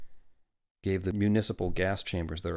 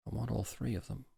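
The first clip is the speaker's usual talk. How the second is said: soft, slightly higher plea; quiet; 4 semitones higher, 7 dB quieter than usual. A narrator's reading. Father wants all three babies, quietly. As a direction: keep it small and hushed. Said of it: "said Welling quietly".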